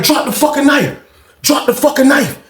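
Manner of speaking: ordering the knife down; retold command. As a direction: insist he drop it. [name] repeating the order.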